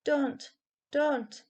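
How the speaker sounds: high pleading moan; feeble moan. A narrator's reading. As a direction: beg weakly in fear. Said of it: "moaned feebly".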